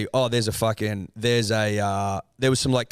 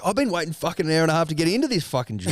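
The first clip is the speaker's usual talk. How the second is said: mock fury, higher and fast; retold fury; 6 semitones higher, faster than usual. Furious driver to the man cutting in, mock fury. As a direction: rattle off an imitated angry complaint. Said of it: "he's fucking furious".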